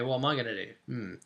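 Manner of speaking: normal talking voice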